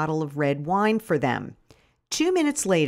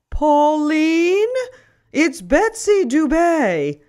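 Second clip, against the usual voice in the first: headmistress on the phone; 9 semitones higher, 7 dB louder, much slower than usual. Acted. Headmistress phoning the narrator.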